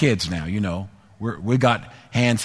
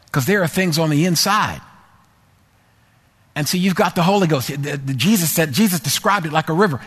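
preaching at full force; 5 semitones higher than usual. Acted. Preacher urging the church to heed the Spirit, fervent and raised. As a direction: rouse the congregation about the Holy Spirit.

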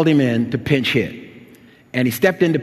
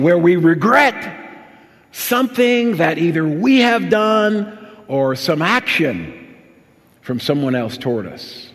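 raised, ringing exhortation to the congregation; full-force preaching; 6 semitones higher, 5 dB louder than usual. Real, not acted. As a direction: preach it out, slowing on the big commands.

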